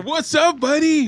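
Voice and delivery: loud, high-pitched hearty greeting; over-friendly. Acted. Winning gambler greeting a stranger, hammed-up cheer. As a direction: greet like an over-eager pal.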